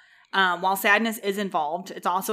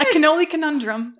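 playful pun; 8 semitones higher, 8 dB louder than usual. Real, not acted. Proud pun announcement.

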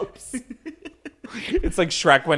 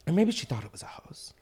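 whispering; 4 semitones higher, 6 dB quieter than usual. Real, not acted. Whispered aside.

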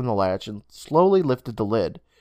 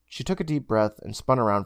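usual speaking voice

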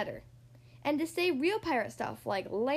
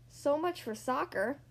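ordinary delivery, nothing marked

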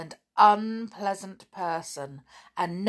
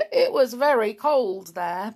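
plain reading voice

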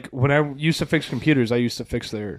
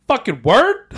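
high, loud incredulous question; retold astonishment; 8 semitones higher, 6 dB louder, slower than usual. Retelling his reply about the Italian mother, loudly incredulous. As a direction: act out being amazed at the news.

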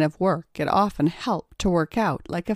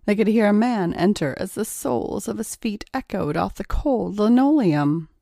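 ordinary delivery

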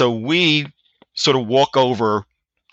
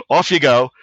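imitated British dismissal; 3 dB louder than usual. American recalling the sergeant major's words, lightly mimicked British accent. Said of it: "a stereotype British accent".

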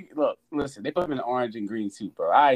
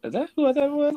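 very high-pitched, louder disbelief; astonished recognition; 6 dB louder than usual. Retold or imitated. Fan recalling Loki's appearance, in high, loud astonishment. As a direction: squeal it in acted astonishment. Acts out surprised recognition of Loki.

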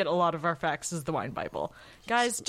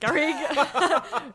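slow, loud, higher call; drawn-out call; 8 semitones higher, 5 dB louder, much slower than usual. Pretend. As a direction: stretch the name out like a summons. Calling the pretend assistant.

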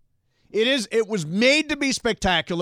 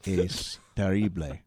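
sexy voice in Spanish; 10 semitones lower, 5 dB quieter than usual. Shown as a demonstration. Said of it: "it sounded odd".

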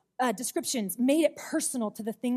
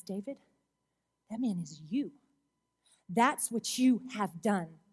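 quiet accusation; 5 semitones lower, 6 dB quieter than usual. Retold or imitated. Prophet Nathan quietly tells King David he is the man. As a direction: drop the voice and let it land.

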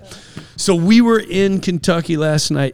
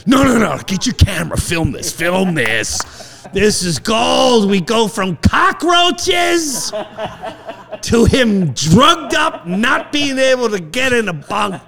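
excited yelling; 7 semitones higher than usual. Retold or imitated. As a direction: shout it like a delighted showman. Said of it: "I was like".

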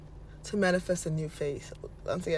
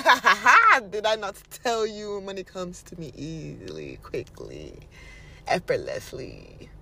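smug certainty; 2 semitones lower than usual. Acted. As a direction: boast as if it were obvious. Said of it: "Just be like".

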